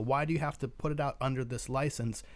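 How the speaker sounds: ordinary talk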